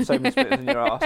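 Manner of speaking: cracking up while quoting the jibe; laughing quote. Retold or imitated. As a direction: giggle the punchline out.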